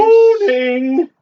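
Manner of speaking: high, rising, loud "Morning"; high sing-song greeting. Acted. The cat greeting its owner, a high, loud, rising "Morning". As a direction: chirp it like a cheery pet at dawn.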